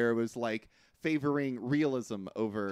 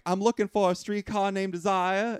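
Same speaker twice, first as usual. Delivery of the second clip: put-on higher-pitched New Orleans lady accent; Southern lady accent; 7 semitones higher, 3 dB louder than usual. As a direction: imitate a Southern belle asking her way. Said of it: "you're doing an accent for all the ladies".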